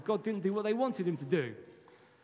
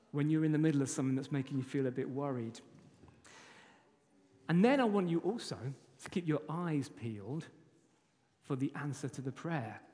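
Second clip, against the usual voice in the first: ordinary delivery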